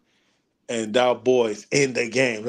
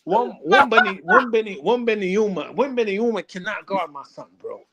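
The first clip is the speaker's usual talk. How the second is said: higher and louder proud bragging; hyped boast; 7 semitones higher, 6 dB louder than usual. Real, not acted. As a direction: shout it to the room. Hyped boasting about son.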